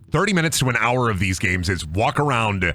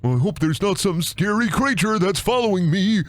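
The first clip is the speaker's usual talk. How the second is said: higher, put-on worried voice; mock fear; 5 semitones higher than usual. Streamer making fun of horror game, mock fearful voice. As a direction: play the naive victim up high. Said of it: "I'm making fun of this".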